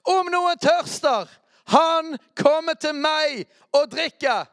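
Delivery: loud shouted quote. Acted. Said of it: "så må vi rope".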